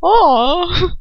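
high squealing aww; gushing aww. Real, not acted. Reader swoons at the romance, high aww. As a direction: coo over the cute moment. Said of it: "reader gushing".

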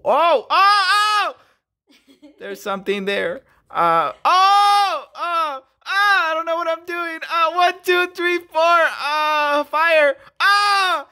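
high, loud excited yelps; excited shouting. Real, not acted. Pack opener freaking out over a hit, shouting high. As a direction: yelp with excitement at the pull. Pulling a rare card, shouting.

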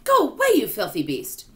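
loud, high scolding shout. A narrator's reading. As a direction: yell it to chase off a beast. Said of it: "they shouted".

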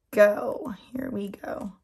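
ordinary delivery

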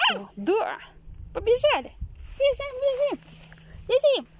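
high, loud, excited squeal; squeaky excited dog call. Real, not acted. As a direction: call the dog to run, thrilled. Calling the dog to run.

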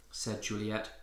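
usual speaking voice

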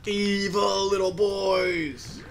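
slow drawn-out shout; dramatic villain voice. Pretend. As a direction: ham it up like a cartoon villain. Hammed-up dramatic line.

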